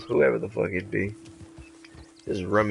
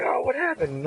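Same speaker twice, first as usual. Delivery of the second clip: mock surprise; 12 semitones higher than usual.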